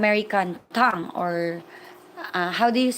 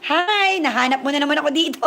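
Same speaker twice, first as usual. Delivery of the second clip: higher, quick, laughing hello; excited greeting; 4 semitones higher than usual. Real, not acted. Greeting her investor who found her livestream, fast laughing hi.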